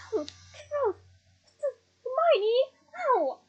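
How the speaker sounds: high, short cries for help; alarmed yelping. A narrator's reading. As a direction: yelp as blows land on you. Ron yelping while being punched.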